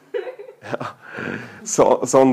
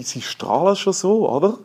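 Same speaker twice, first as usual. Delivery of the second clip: ordinary talk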